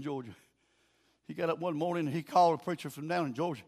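usual speaking voice

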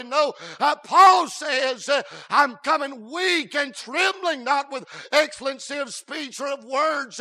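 loud, sung-out preacher cadence; hooting revival preaching; full-force preaching. Acted. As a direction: preach it out to the whole church. Fiery preaching on Paul.

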